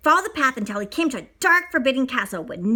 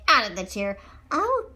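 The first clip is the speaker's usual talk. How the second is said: ordinary delivery, nothing marked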